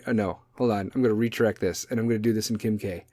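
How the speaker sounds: ordinary talk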